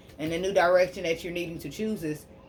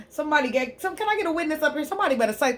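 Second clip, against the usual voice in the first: high excited church call for a witness; rally the room like a preacher; preaching call; 9 semitones higher than usual. Real, not acted.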